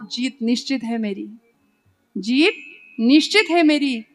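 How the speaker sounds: slow proud couplet building to a loud finish; triumphant declaration. Acted. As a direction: answer with growing confident pride. Arjuna's triumphant reply in verse.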